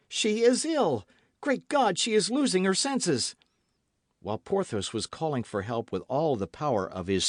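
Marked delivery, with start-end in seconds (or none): 0.1-3.3 high, louder anguished cries; panicked. A narrator's reading. D'Artagnan seeing Constance faint, panicked. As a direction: alarm rising in the voice.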